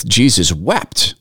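"Jesus wept" said slowly, each word weighted; solemn, drawn-out "Jesus wept"; slow, weighty emphasis. Shown as a demonstration. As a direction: let "Jesus wept" land slow and heavy. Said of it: "The emphasis on that".

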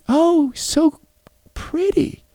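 put-on high, dreamy little girl admiring; a small girl sighing over the lion. Daughter voiced admiring lion.

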